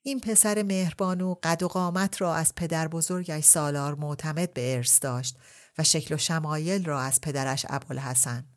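plain reading voice

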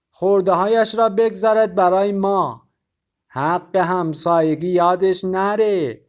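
wheedling neighbour in thick Azeri; Azeri accent. A narrator's reading. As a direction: Azeri shopkeeper hinting for sugar. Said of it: "با لهجهٔ غلیظ آذری".

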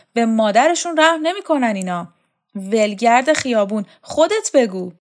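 mock the weakling, then demand an answer; scornful tirade. Shokat mocks and scolds.

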